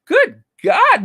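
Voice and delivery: sudden high shriek of alarm; shocked outburst. Pretend. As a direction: yelp it as if the coffee shocked you.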